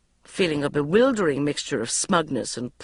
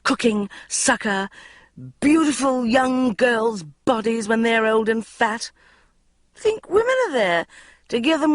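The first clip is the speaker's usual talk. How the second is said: put-on drunken rant; 9 semitones higher, 3 dB louder than usual. A narrator's reading.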